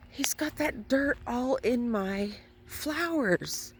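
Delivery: real dismay at buried flowers; shocked dismay. Real, not acted. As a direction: let the shock lift the line, slowly. Discovers dirt on her flowers.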